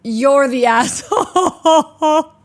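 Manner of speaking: slow, laughing put-on whine; mock whiny voice. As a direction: drag it out in a silly voice. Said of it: "Someone goes".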